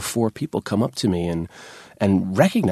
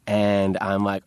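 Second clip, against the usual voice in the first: ordinary talk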